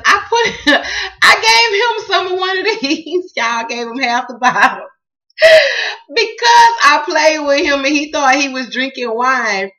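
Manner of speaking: loud, high and laughing through the words; can barely get it out for laughing; real laughter while telling the story; laughing loudly. Real, not acted. Laughing hard retelling son's act.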